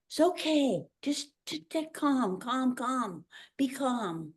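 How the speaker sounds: calm a frightened student gently; soothing teacher. Retold or imitated. Kind teacher soothing a stunned foreign dancer, soft. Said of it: "She came to me and said".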